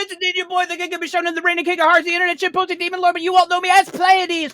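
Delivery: high, fast hype intro; hype announcer. Acted. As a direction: announce yourself like a wrestler.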